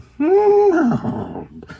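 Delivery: high whinny falling away into a snort; horse whinny. Acted. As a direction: neigh like a horse. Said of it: "What does the horse say?".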